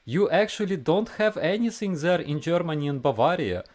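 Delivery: put-on high-pitched Russian babushka accent. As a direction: voice an old Russian grandma. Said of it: "I will read it for you in a Russian accent".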